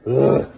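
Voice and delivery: short, loud groan; weary grunt. Real, not acted. Dad with a lot to do right now, weary grunt. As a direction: let out a heavy ugh. Effort or weariness amid chores.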